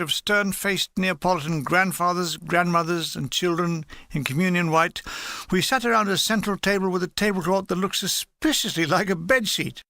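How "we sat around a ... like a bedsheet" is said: ordinary delivery, nothing marked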